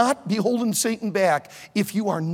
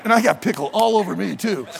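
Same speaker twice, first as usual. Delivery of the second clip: ordinary talk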